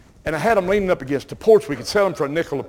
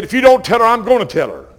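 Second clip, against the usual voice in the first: stern threat; 6 semitones higher, 8 dB louder than usual. Retold or imitated. Store guard threatening boy.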